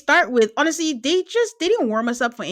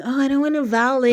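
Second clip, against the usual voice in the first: lightly mimicked reality-show excuse, pitched up; a higher, hedging mock quote; Huda; 4 semitones higher, 3 dB louder than usual. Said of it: "It was giving Huda on".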